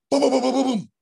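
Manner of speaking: rapid high, loud booms; quick burst of mimicked banging; imitated commotion. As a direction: imitate running thuds at the back door. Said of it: "I hear the back door".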